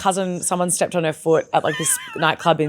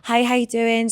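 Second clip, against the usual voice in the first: higher Tesco-worker Essex voice; Essex accent; 4 semitones higher than usual. Acted. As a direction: put on a chatty Essex accent. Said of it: "Tesco would be like".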